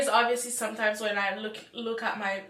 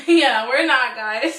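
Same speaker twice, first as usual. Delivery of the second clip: laughing; 2 semitones higher, 12 dB louder, slower than usual. Real, not acted. Joking about not being robots.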